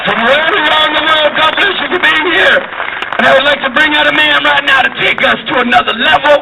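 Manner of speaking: high, projected show introduction; stage announcer. Real, not acted. Host bringing out the next act, high-energy announcement. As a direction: pitch it high, hyping up the crowd.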